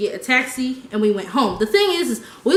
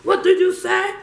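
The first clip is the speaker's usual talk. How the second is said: mock aggressive challenge; 7 semitones higher than usual. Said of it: "he was like".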